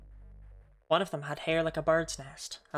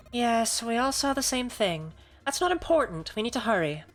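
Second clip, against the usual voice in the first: higher, louder, urgent; impatient woman; 6 semitones higher, 5 dB louder than usual. Acted. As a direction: press them to hurry. Dancer urges the group.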